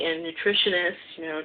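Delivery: ordinary talk, nothing marked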